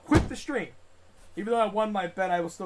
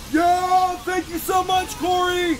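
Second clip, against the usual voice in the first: excited shout; 8 semitones higher than usual. Real, not acted. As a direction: hype up the donor. Excited shouted donation thanks.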